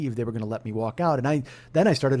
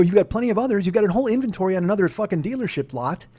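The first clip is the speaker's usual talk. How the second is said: higher-pitched, indignant customer pushing back; retold arguing; 7 semitones higher than usual. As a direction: argue your case, exasperated. Retold argument with car salesman.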